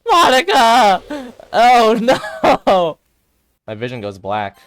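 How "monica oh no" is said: very high, very loud, drawn-out screaming; scared screams. Acted. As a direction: scream the name out in terror. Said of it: "Sayori's scared screams".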